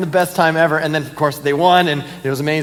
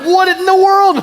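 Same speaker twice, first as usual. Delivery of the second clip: high, louder cry of disbelief; stunned disbelief; 14 semitones higher, 5 dB louder, slower than usual. Retold or imitated. Fan at airport sees his team take the lead, stunned shout. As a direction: gasp it out like you can't believe the score. Retold shock at game comeback.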